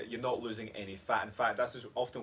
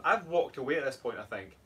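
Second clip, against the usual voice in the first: normal talking voice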